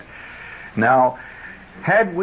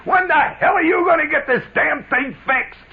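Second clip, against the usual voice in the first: high, outraged holler; 12 semitones higher than usual. Retold or imitated. Schwartz in the back seat yelling at the driver, exasperated. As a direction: blow up at the driver. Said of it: "Schwartz hollers out".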